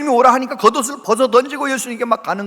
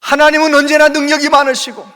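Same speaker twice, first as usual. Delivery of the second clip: louder, higher fervent sermon climax; preaching at full force; 6 semitones higher, 6 dB louder than usual. Acted. Pastor rousing the congregation, full-force preaching. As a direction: proclaim it to the whole church.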